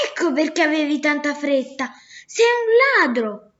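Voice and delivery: the merchant explodes and calls him a thief; angry accusation. Acted. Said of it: "ed esclamò".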